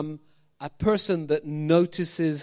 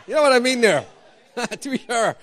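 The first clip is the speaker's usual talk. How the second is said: hammed-up Irish lilt, breaking into laughs; mock Irish accent; 5 semitones higher, 7 dB louder than usual. Pastor clowning for the church, laughing mock Irish accent. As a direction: push the stage Irish for a laugh. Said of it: "Can't do Scottish".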